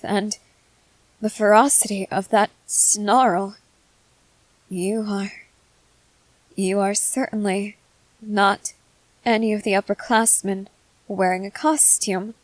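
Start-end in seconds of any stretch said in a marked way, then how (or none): none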